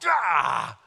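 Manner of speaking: sudden growing frustrated shout; acted burst of inner frustration; frustrated yell. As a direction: let out an exasperated "aah". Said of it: "I can feel in myself".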